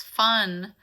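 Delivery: ordinary talk, nothing marked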